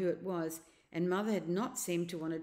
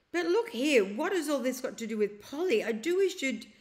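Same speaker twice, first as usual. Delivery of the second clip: put-on a boy's urgent protest, higher and louder; impatient boy; 7 semitones higher, 7 dB louder than usual. A narrator's reading. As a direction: press him, cut off mid-plea. Diggory impatient about Polly.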